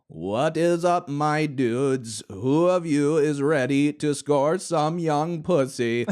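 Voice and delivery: high, loud, slow robotic Southern voice-machine drawl; speech synthesizer voice. Acted. Hawking greeting the men through his voice machine, robotic and loud. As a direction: hammer each word like a text-to-speech box. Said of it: "typing a message into his speaking machine".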